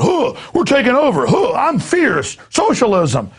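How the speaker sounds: high, squeaky mock youth chant; mock kid activist. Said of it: "kids in red uniforms dancing around like going".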